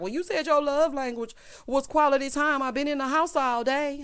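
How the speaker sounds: lightly acted grumbling partner; mock complaint. Imagined man's complaint.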